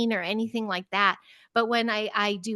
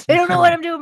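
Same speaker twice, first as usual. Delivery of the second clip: bursting into laughter mid-sentence, higher and louder; laughing; 8 semitones higher, 10 dB louder, faster than usual. Real, not acted. Teacher laughing to the host about parents who don't know what she does. Laughing at her own secret.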